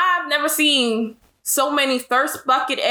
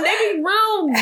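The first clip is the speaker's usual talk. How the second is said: ordinary talk, nothing marked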